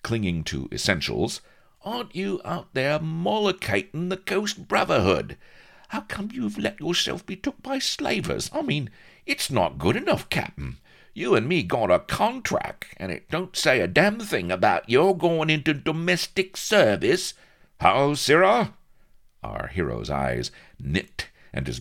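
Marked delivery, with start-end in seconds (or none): 1.8-17.4 high squeaky nagging; indignant little sidekick laying down terms; Vladimir, exasperated. Acted. Vladimir scolds captain. 17.8-18.7 loud, high outraged challenge; haughty old-time exclamation; affronted heroic captain. Acted. Avery affronted.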